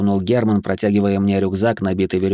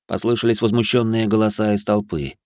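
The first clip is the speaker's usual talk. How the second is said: ordinary delivery, nothing marked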